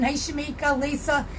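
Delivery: normal talking voice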